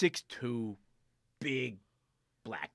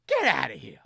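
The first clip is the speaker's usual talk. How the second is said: loud get out; angry dismissal; 14 dB louder than usual. Retold or imitated. Richie sending the teller away, loud angry. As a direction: throw him out. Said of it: "It's like".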